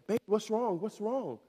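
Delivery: put-on high, soft voice for a small child; soothing parent. Dad kneeling to his crying daughter, soothing. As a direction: gently coax an upset little one.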